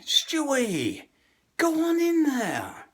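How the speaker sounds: high, drawn-out Brooklyn call; Joe guilting. Retold or imitated. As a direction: pull him in with a guilt trip. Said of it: "this is how Joe guilts me".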